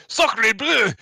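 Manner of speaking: laughing French exclamation; french chef. Acted. Laughing burst of mock French.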